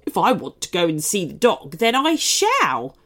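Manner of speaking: stamp your foot in words; defiant cupcake. Acted.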